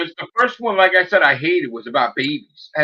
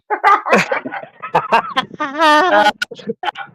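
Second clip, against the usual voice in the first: a sudden high mocking laugh; gloating high-pitched laugh; 12 semitones higher than usual. Real, not acted. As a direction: laugh at him, high and gleeful.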